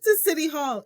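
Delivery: high, laughing through the words; giggling finish. Real, not acted.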